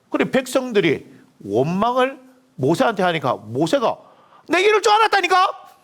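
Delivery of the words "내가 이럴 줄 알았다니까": much higher, louder exasperated cry; exasperated Moses. Acted. Moses complaining about his grumbling people, exasperated high shout. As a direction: throw up your hands in a whiny shout. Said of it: "그렇게 얘기합니다".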